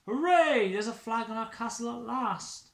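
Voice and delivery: high loud hooray; cheering. Acted. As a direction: cheer it triumphantly. Dad cheers.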